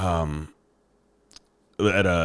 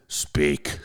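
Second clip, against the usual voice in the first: low, curt one-word demand; brusque, lowered phone answer; rude; 5 semitones lower than usual. Retold or imitated. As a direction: imitate answering the phone rudely. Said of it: "I was being kind of rude to him".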